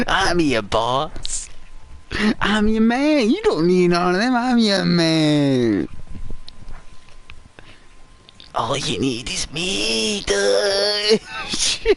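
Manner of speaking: the devoted henchman begging Dutch; hammed-up needy sidekick; Mike voice.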